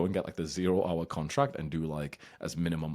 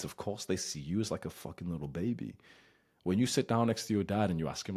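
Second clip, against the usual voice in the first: ordinary talk, nothing marked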